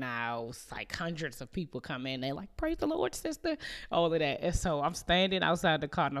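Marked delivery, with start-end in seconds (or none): 2.5-3.6 high, sing-song church lady greeting; churchy greeting. Retold or imitated. Church members greeting the teller after service, high and sing-song. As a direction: chirp it like the whole congregation is greeting you.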